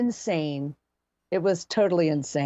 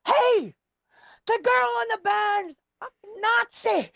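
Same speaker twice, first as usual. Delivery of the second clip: mock scream. Drunk woman yells to the packed bar about the singer, high mock yell. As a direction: call it out like a drunk across the room. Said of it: "She screamed out".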